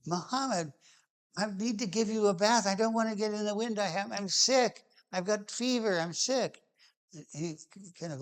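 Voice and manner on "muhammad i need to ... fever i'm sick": put-on higher, pleading whine of a sick kid; whiny child. Pleading with Muhammad to skip the bath, higher childish whine. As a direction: whine it like a child who wants out of the chore. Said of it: "like a whiny child".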